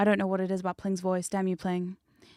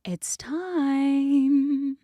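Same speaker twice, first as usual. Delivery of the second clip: loud, higher, long drawn-out announcement; mock menace; 6 semitones higher, 6 dB louder than usual. Host looming with a sledgehammer, drawn-out mock menace. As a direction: announce it big, holding out the last word. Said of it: "me with a sledgehammer like".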